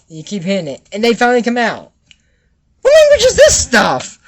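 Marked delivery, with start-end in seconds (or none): 2.8-4.2 very high, loud squeal of disbelief; baffled exclamation. Acted. Baffled reaction to the spell.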